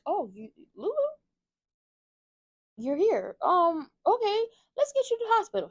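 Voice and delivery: shocked to find her home, then flustered; stunned husband. Retold or imitated. Dante finding Lulu at the door, startled and flustered. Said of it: "he was like".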